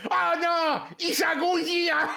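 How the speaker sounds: high, hammed-up cry of alarm; panicked Japanese movie extra. Joking about what the film lacks, hammed-up Japanese panic. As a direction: cry out like a monster-movie bystander. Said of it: "There's no Japanese guy going".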